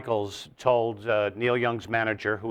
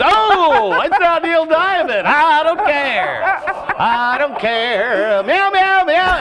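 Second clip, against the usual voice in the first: very high, loud, slow silly heckler; mock crowd voice; 9 dB louder, much slower than usual. Comic mocking the heckling crowd, high and loud. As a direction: jeer like a goofy drunk fan.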